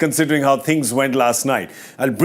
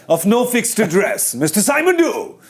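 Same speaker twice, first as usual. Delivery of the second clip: mock ring announcer, rising high on the name; playful fight-night introduction; 8 semitones higher than usual. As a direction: introduce a boxer, stretching it out. Mock boxing introduction of a guest.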